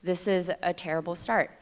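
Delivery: ordinary talk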